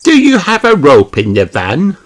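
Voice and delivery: put-on high, loud little girl piping; Peppa voice. Peppa asking Mister Fox, high eager child voice. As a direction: ask eagerly like a small child.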